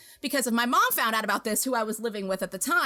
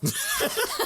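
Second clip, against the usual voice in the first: laughing; 12 semitones higher than usual. Real, not acted. Laughs at own story.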